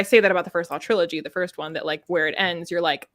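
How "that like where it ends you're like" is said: ordinary talk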